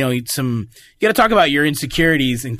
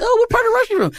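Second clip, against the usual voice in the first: very high falsetto question; put-on girly falsetto; mock party girl; faster than usual. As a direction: imitate a gullible girl, squeaky and curious. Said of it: "they're like".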